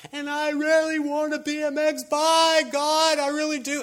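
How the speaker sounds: put-on very high, loud kid yelling to God; screamed prayer. Kid praying at bedside for a bike, acted high scream. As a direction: shout the wish as if God were deaf. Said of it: "you don't have to scream at God".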